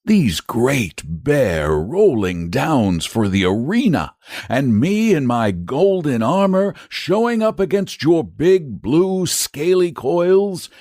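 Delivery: high, slow, painting a grand picture; persuasive, dreamy. A narrator's reading. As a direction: sell the glory of the fight.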